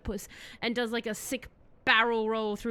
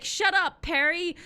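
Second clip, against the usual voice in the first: loud, higher snapping order; mock angry shout; 7 semitones higher, 6 dB louder than usual. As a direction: shout him down. [name] snaps at kidnapped Perry.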